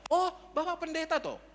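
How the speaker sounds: high-pitched, acted surprise; surprised realization. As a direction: imitate someone suddenly finding out. Landlord learns caller is pastor.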